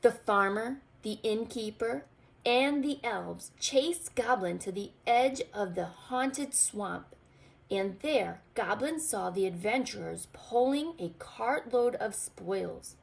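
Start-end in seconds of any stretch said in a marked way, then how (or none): none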